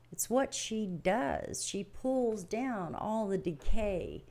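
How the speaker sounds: slow, gentle reverent voice; reverent teaching. Acted. Teacher to listeners about the earth, slow and reverent. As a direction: speak of the earth slowly.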